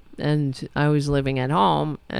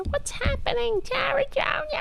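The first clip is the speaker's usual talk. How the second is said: high-pitched motherly call to the cat; 5 dB quieter than usual. Acted. Talking to her cat, in her mother's high voice. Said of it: "That mother voice, right?".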